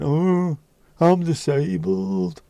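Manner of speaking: high, drawn-out mock wail. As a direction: ham up a helpless lament, high and slow. Mock collapse act.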